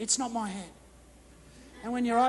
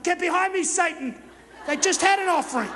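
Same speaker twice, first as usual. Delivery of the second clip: a sharp, raised, higher "get behind me" at the tempter; mock-outraged retort; indignant rebuke; 6 semitones higher, 5 dB louder than usual. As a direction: push the thought away, loud and affronted. Rebuking the voice as Satan.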